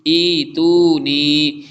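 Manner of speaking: "ई तू नी" with every vowel stretched slow and loud; long vowels held. Shown as a demonstration. Teacher showing the student the right reading, slow. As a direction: hold each long vowel. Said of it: "इसको ई तू नहीं पढ़ेंगे".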